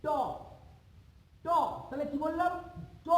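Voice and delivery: ট said clearly as a retroflex sound; demonstrating ট. Shown as a demonstration. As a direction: say ট with the tongue tip curled back. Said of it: "দেখো আমি উচ্চারণ করছি". (জিভ উল্টে স্পষ্ট করে ট)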